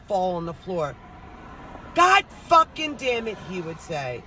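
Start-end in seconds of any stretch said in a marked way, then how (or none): 2.0-3.4 high-pitched angry cursing; dad screaming curses. Retold or imitated. Dad after falling on the floor, imitated angry yell. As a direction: imitate a furious dad swearing loudly. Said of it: "you hear my dad screaming".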